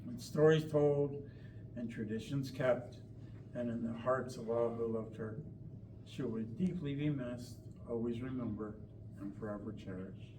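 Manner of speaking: measured, heartfelt memorial address; eulogy reading. Real, not acted. Daughter reading her mother's eulogy, measured and heartfelt.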